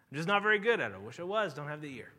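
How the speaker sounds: ordinary delivery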